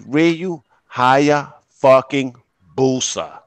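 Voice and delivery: broken up slowly, word by word; deliberate, spaced-out emphasis; slowed down. Shown as a demonstration. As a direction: spell it out slowly for listeners. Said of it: "please say it a little slower".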